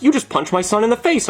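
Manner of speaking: lightly voiced indignant mom; upset mom. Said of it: "his mom runs over, and she's like".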